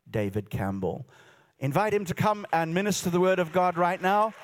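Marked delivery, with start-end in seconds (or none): 1.6-4.3 loud, raised-pitch introduction; rousing welcome. Real, not acted. Host welcomes guest preacher to the platform, rousing loud welcome. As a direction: call the guest up over the crowd.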